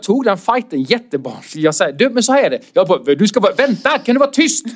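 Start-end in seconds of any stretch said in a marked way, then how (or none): 1.9-2.6 higher, heated telling-off; scolding. Retold or imitated. Comic telling off the heckler, heated and higher. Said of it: "skällde ut honom". 3.1-4.7 higher, heated telling-off; scolding. Retold or imitated. Comic telling off the heckler, heated and higher. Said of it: "skällde ut honom".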